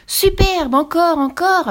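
high, loud gleeful cheer; jubilant. A narrator's reading. Pierre egging on the duelling witches, jubilant. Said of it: "applaudit Pierre en jubilant".

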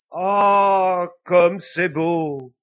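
loud, drawn-out tearful exclamation; deeply moved. Acted. Pope moved by the devil's story, tearfully exclaiming. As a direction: marvel slowly, high and overcome. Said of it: "murmura-t-il d'une voix émue".

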